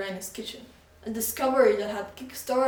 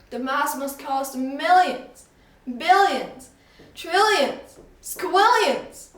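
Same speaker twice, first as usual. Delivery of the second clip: climbing higher and louder with each word; building excitement; 6 semitones higher, 9 dB louder, much slower than usual. Acted. Shopkeeper guesses the mask's value, climbing higher and louder. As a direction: build from millions to a squealing squillions.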